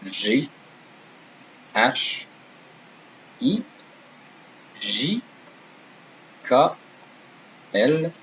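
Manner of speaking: each French letter name said singly, evenly spaced pauses; French alphabet drill with a lift on most letters. Shown as a demonstration. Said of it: "first say all the letters without any comments".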